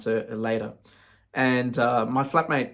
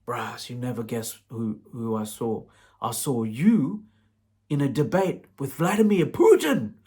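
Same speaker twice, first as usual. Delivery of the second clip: slow, drawn-out brother voice; imitating brother. Brother describing the Putin dream, slow put-on voice. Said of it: "That's how he talks".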